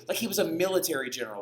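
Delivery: ordinary delivery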